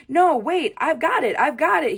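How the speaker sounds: loud, high, thrilled shout; jumping-up-and-down excited yell; shouted real excited. A narrator's reading. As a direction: burst out with the big idea. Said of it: "he shouted real excited".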